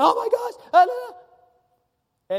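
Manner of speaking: high-pitched, loud acted hysteria; mock panicked scream. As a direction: wail it out in panic, dragging the no's. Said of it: "screaming, and going".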